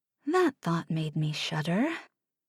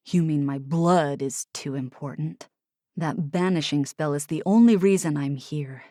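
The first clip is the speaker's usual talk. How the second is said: bitter Oliver; 5 semitones lower than usual. Acted. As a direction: lower voice, sardonic.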